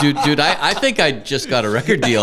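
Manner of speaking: high and loud, laughing through the news; excited news. Retold or imitated. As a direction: blurt it out like you can't believe your luck. Bursting in with big news.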